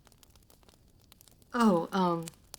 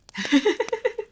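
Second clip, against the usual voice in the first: sudden high bright laugh, louder; high startled laugh; 8 dB louder than usual. Acted. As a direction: burst out laughing in surprise. Surprised laugh at lip request.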